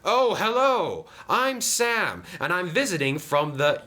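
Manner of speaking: high, eager greeting; Sam the friendly engine. Acted. Sam greets Diesel as a visitor, friendly. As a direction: introduce yourself brightly, pitched well up.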